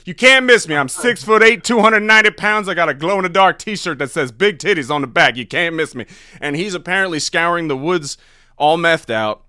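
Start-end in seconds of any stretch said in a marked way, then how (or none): 0.0-6.1 much higher, loud, cheerful stranger voice; prank caller Dewan. Retold or imitated. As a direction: boast the absurd details straight-faced. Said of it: "I'm like".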